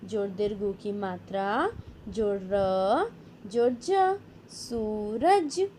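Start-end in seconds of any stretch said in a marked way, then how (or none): 4.5-5.7 very slow, each sound held; slow drawn-out word. Shown as a demonstration. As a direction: sound it out for young pupils. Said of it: "spelling drill, slow blended word".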